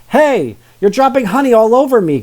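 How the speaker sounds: high, loud annoyed complaint; complaining. Acted. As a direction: whine it at the sister. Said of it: "Phil complained".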